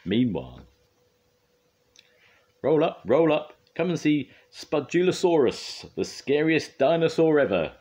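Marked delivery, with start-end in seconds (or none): 2.6-7.7 proud showman's call, high and loud; Spud. A narrator's reading. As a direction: holler like a fairground barker. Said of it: "Spud called proudly to Travis".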